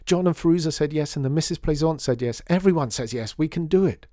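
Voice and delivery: high, loud and breathless with joy; a girl telling the shopkeeper everyone agreed; excited shouting. A narrator's reading. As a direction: keep yelling it, thrilled. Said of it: "shouted Lydia".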